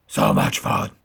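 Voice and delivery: loud low gravelly growl; deep growling Batman. Pretend. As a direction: say it again as Batman. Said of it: "repeated in Batman voice".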